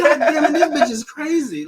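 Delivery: 높은 목소리로 웃으며 외치듯, 반쯤 장난스럽게; 웃음 섞인 채로 감탄하듯 말해; laughing exclamation. Retold or imitated. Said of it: "And I was like".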